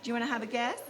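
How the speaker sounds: ordinary delivery, nothing marked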